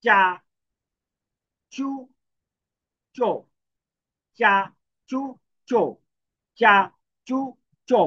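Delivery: চা, চু, চো said as cha, chu, cho, each syllable crisp and separate; teacher repeating চা চু চো for students; clear syllable drill. Shown as a demonstration. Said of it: "তাহলে কি হবে উচ্চারণটা?".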